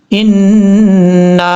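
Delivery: इन्ना with long nasal ghunna on the doubled n; ghunna recited. Shown as a demonstration. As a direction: hold the nasal hum of इन्ना. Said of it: "गुन्ना करेंगे". (इन्ना को गुन्ना के साथ लंबा पढ़ें)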